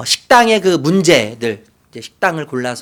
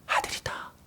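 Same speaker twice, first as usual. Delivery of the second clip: breathed out in a whisper; 15 dB quieter than usual. Pretend. Mother claiming her son, whispered. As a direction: let it fall to a whisper.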